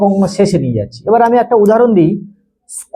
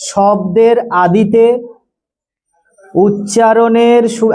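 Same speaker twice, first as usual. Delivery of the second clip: slow dictation; slower than usual. Shown as a demonstration. Dictating definition to class.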